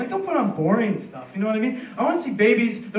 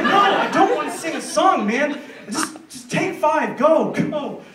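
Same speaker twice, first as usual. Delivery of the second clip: exasperated laugh; 6 semitones higher, 4 dB louder, slower than usual. Retold or imitated. Shooing the guy off, laughing.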